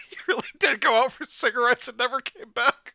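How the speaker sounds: high laughing through the line. Real, not acted. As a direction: giggle through it.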